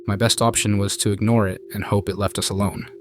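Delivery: ordinary delivery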